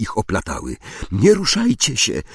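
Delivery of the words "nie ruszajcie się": high, urgent warning cry; raised-pitch exclamation. A narrator's reading. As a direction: call out a sharp order to freeze. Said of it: "krzyknęła Hermiona".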